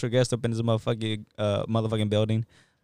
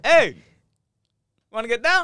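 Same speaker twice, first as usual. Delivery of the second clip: high, loud mock challenge; calling someone out; 12 semitones higher, 9 dB louder than usual. Host imitates a bum challenging someone, high loud call-out. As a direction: holler it like a street bum itching to fight. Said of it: "he just calls him out".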